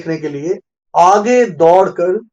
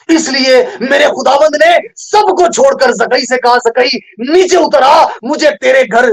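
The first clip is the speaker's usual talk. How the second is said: high-pitched, ringing proclamation; impassioned preaching; 7 semitones higher, 3 dB louder than usual. Acted. Preacher voices the Lord calling Zacchaeus down, impassioned preaching. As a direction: call it out to the whole congregation.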